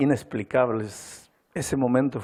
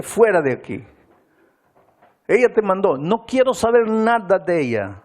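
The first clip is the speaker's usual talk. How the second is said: voicing the furious husband, raised; angry shout; 8 semitones higher, 5 dB louder than usual. Husband ordering the pastor out, angry raised voice. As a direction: snap it out, throwing the pastor out.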